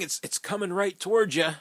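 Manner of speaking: ordinary talk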